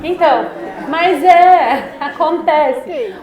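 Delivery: loud, high, amused recovery; still laughing. Real, not acted. (ainda rindo, alto e agudo)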